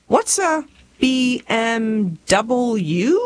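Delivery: slow, puzzled sounding-out of letters; naive question. Acted. Country girl asking rich classmates about their cars, slow naive puzzlement. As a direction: spell it out slowly, as if never heard.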